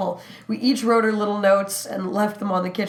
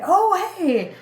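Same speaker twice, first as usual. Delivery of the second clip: bright greeting; 12 semitones higher, 3 dB louder than usual. Retold or imitated. Greeting coworker mistaken for twin.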